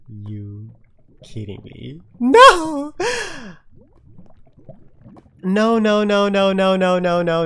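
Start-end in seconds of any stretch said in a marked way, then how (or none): 2.2-7.5 a real shout of no that fades back to normal; frustrated yell. Real, not acted. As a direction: start screaming high and loud, then let each no die down. Frustrated outburst at forgotten crafting table.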